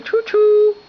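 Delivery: high, loud choo choo call; train noise. Pretend. Dad making the spoon a train, loud high choo choo. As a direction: play the spoon train for the baby.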